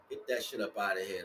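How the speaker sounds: ordinary delivery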